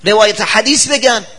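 high, loud, fast protest; high loud indignant outburst; indignant retort. Retold or imitated. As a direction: protest loudly against the praise. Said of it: "گفت".

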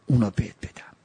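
whispered repeat of اونا بهت بدم, no voice. Shown as a demonstration. Repeats line, second time whispered.

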